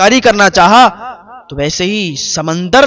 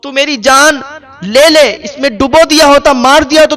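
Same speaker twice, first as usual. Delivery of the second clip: high, loud, despairing cry to God; anguished lament; 6 semitones higher, 3 dB louder than usual. Acted. Trapped woman crying to God in despair, high and loud. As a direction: wail that death would be better.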